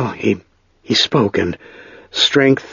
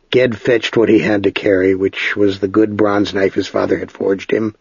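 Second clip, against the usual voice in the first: ordinary delivery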